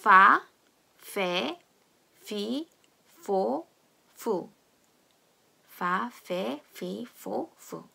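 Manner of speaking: "파, 페, 피, 포, 푸" as fa, fe, fi, fo, fu; F pronunciation. Shown as a demonstration. Teacher leading practice, separate f syllables "파, 페, 피, 포, 푸". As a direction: drill each syllable of "파, 페, 피, 포, 푸" with English f. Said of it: "발음을 같이 해보겠습니다".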